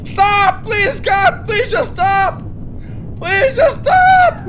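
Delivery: loud, high-pitched cries of panic; panicked pleading. Pretend. Pilot begging his plane to stop as brakes fail, panicked shouting. Panic during failing landing.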